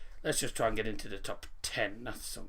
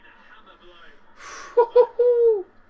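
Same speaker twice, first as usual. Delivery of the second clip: high laugh; 11 dB louder than usual. Real, not acted. Laughing at the chaos on the pitch.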